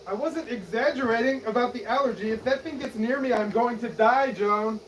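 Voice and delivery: high, loud desperate begging; panicked pleading. Acted. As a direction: plead urgently as if your life depends on it. Begs, fearing a fatal sting.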